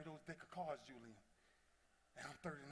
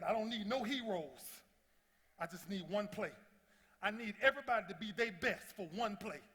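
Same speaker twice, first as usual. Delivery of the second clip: rallying call; 3 semitones higher, 7 dB louder than usual. Retold or imitated. Leader to teammates on the field, rallying loudly. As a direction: fire up your warriors for one play.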